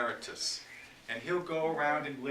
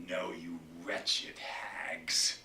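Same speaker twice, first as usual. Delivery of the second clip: slow whispered curse; venomous whisper; 8 semitones lower, much slower than usual. Acted. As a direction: spit the curse low and unvoiced. Whispered curse in Lear speech.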